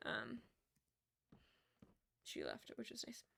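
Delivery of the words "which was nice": usual speaking voice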